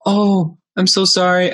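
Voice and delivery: high-pitched imitation of an apologetic lady; sympathetic lady. Staffer hearing his internet crashed, high and sympathetic. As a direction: imitate her sorry response, pitched well up. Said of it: "then the lady's like".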